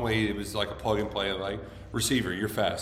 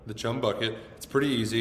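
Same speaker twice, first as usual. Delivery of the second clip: ordinary talk, nothing marked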